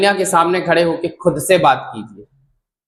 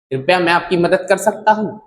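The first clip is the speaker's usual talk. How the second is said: normal talking voice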